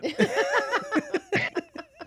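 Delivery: high burst of laughter; big laugh. Real, not acted. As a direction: let the laugh explode. Laughing at own punchline.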